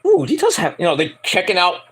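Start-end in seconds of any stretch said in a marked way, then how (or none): 0.1-0.8 mock admiring coo. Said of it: "they're going like".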